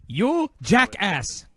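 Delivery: slow, high, loud imitated insult; Shinsuke impression. Wrestler answers his rival's taunt, slow hammed-up insult. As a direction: draw out each word like the wrestler's retort. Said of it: "then Shinsuke went".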